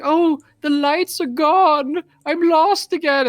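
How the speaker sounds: high, loud, slow wail; hammed-up lament; mock despair. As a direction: whine it like a lost melodramatic child. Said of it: "he's like".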